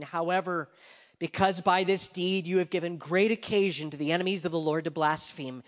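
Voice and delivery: loud, high psalm reading; scripture reading. Acted. Preacher reads David's psalm aloud, declaiming. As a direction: proclaim the psalm to the room.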